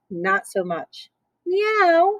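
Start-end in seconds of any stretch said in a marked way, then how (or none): 1.5-2.2 high meow; cat meow. Acted. Cat climbing aboard, a high meow. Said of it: "said Cat".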